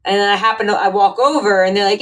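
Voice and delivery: usual speaking voice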